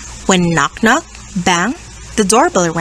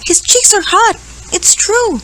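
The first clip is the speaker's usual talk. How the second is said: blaring; 10 semitones higher than usual. Acted. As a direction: blare it out worried. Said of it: "Gorillas wrap him up and blared".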